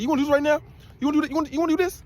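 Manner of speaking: high, fast, confrontational challenge; angry officer. Retold or imitated. Guard snapping at the smiling arrestee, high and fast. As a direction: square up at him, rattled off fast. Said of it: "He got mad and said".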